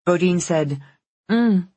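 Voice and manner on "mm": humming through a mouthful; mouth full. A narrator's reading. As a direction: mm with food in mouth. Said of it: "looked up with her mouth full".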